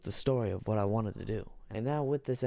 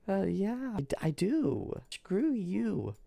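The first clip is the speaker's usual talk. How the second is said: Czechia voice; 7 semitones higher, slower than usual. Acted. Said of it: "So Czechia is like".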